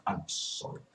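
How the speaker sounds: ordinary talk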